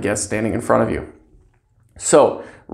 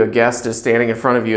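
ordinary delivery

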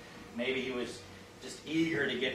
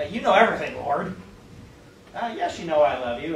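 louder, pressing Peter's reply home; more emphatic; 7 dB louder than usual. Retold or imitated. Peter answering Jesus the third time, more emphatically. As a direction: insist on it, a bit more emphatically. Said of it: "a little bit more emphatically".

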